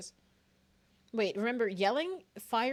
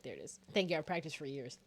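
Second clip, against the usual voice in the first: ordinary talk, nothing marked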